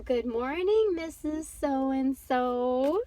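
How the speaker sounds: loud, slow sing-song pupils' chorus; class greeting. Retold or imitated. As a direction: chant it like a whole class greeting the teacher. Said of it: "everyone stands up from their seat and say".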